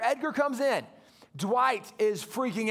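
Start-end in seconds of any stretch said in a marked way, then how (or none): none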